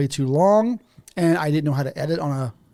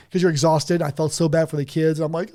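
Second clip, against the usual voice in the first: ordinary delivery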